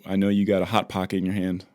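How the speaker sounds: ordinary delivery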